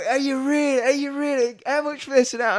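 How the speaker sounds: high, loud, eager mock punter voice; mock gushing punter. Mocking an eager punter on holiday, high and gushing.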